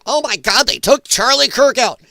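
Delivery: imitating breathless tinfoil panic; mock conspiracy panic. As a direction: play the frantic conspiracy theorist. Mocking conspiracy theorists' panic.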